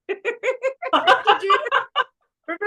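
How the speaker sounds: high laugh through the words. Real, not acted. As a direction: laugh it out, overcome. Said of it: "I'm crying and I'm laughing".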